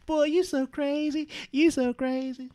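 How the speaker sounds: high, soft and slow flirty woman's voice; mock flattered woman. A woman answering a flirting man, high coy coo. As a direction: coo it back like she's flattered.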